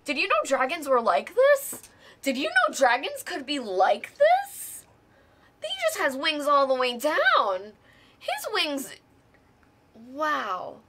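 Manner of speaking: loud, high, amazed gushing; awestruck excitement. Real, not acted. Host showing off her new dragon, loud awestruck excitement. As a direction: marvel out loud, pitch leaping up.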